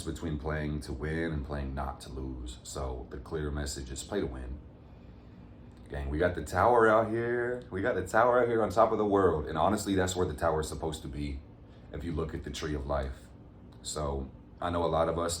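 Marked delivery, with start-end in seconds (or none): 5.9-9.5 excited. Real, not acted. Excited announcing the Tower card.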